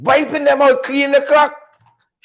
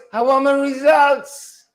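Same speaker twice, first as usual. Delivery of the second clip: slower, higher imitation of Brooms; 5 semitones higher, slower than usual. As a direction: mimic Brooms, drawn out and raised. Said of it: "In my Broom's voice".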